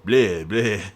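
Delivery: hammed-up vampire "Bleh, bleh" with a falling tune; a cartoon vampire's mock "Bleh, bleh"; mock Dracula bleh. Friend teasing the Dracula voice, hammed-up bleh. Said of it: "I do not go bleh".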